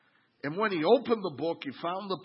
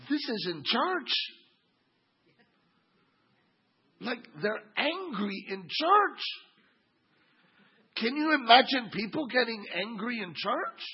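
incredulous; 8 semitones higher, 4 dB louder than usual. Real, not acted. Preacher marveling at angry church.

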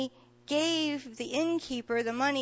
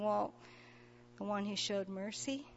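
soft, sheepish mumble with head hung low; a shamefaced, much softer answer; 4 semitones lower, 12 dB quieter than usual. Retold or imitated. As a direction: admit it quietly, embarrassed. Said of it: "very sheepishly kinda hanging his head".

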